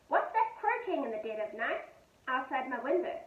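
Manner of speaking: usual speaking voice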